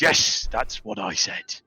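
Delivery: lightly voiced parroting bird; Kenku echo. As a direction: mimic the line back as a Kenku. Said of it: "And not Krim responds".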